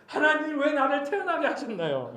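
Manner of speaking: high-pitched mock protest; indirect complaint. Job complains to God about being born, acted high complaint. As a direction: whine upward at God in a performed grievance. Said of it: "살짝 돌려서 불평하죠".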